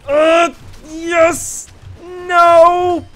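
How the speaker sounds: high, loud cries; yelped reactions. Real, not acted. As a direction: shout in triumph, then dismay. Kill, then death in game.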